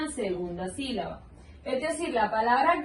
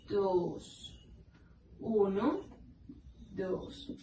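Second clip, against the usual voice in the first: soft counting; 5 semitones lower, 8 dB quieter, much slower than usual. Shown as a demonstration. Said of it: "a ir separando".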